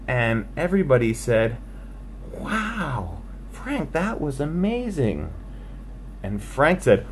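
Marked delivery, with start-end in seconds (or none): 2.4-5.3 hushed awe. Acted. Crowd amazed, hushed.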